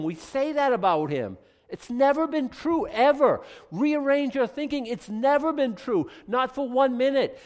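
loud, high, emphatic pulpit delivery; full-force, punched sermon phrases; impassioned preaching. Real, not acted. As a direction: insist on it to the congregation.

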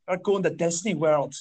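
slow, exaggerated imitation of an Irish accent; attempted Irish accent. Comic mimicking Irish girls they met, hammed-up Irish accent. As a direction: put on a bad, drawn-out Irish accent. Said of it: "Actually, they didn't sound like that".

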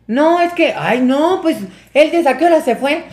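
high-pitched flustered protest; nervous excuse, imitated. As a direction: plead innocence, hammed-up. Youth nervously covering for his friend. (excusa nerviosa y aguda, imitada)

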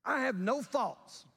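high, drawn-out self-important boast; pompous. Acted. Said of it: "very pompously says".

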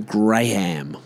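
slow "Graham", both halves full, "Gray-ham"; spelling pronunciation. Shown as a demonstration. Explaining the name to listeners, spelling pronunciation. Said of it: "the name Graham".

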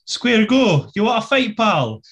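imitating a Glasgow street challenge; glasgow street accent. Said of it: "Ilya eventually goes".

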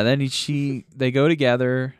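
normal talking voice

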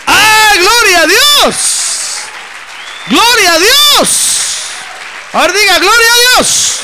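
high, loud, drawn-out praise; fervent loud preaching; preaching exclamation. Real, not acted. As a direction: shout glory to God to the congregation.

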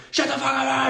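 high-pitched mock shout; imitated yelling. Retelling how the tripping roommate jumped up at Frankie, mock yelling. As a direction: re-enact his sudden yell in a high, strained voice. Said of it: "just starts yelling at him".

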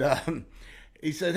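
ordinary delivery

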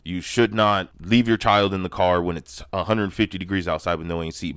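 ordinary talk

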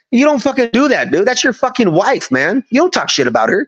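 high-pitched heated scolding of the soldier. Retold or imitated. Sergeant scolding a soldier for insulting his wife, high and heated. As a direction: climb up high and lay into him, half-mocking. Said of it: "I laid into in front of everybody".

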